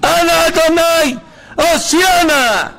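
very high, drawn-out full shout; loud cry-out. Shown as a demonstration. Preacher leading listeners to cry for salvation, loud shout. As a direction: cry it out to heaven, slow and loud. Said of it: "נצעק, תצעקו אחריי, ככה חזק".

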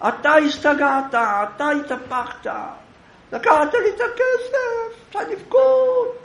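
high, loud, crying accusation; crying scream. Acted. Accuser crying and screaming at the man who took his money. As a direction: wail it out like you were robbed. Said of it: "וצורח".

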